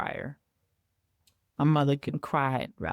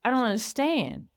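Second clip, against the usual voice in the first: incredulous; 3 semitones higher than usual. Retold or imitated. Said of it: "that's what's going through my head".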